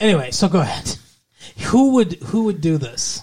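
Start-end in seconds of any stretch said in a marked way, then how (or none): 1.6-2.1 higher, louder hit on the opening words; lightly hammed-up sitcom incredulity; mock actress delivery. Resuming co-host's question, a higher and louder mock delivery.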